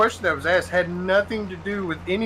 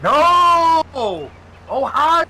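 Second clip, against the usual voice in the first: high, loud, drawn-out holler; heckler's shout; 12 semitones higher, 8 dB louder, much slower than usual. Retold or imitated. Imitating someone in the Cleveland crowd correcting Biden, shouting. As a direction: yell a correction from the crowd, stretching the vowels.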